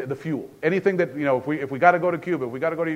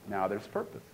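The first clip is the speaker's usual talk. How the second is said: ordinary talk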